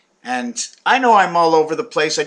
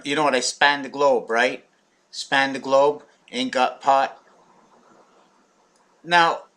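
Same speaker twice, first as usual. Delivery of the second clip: usual speaking voice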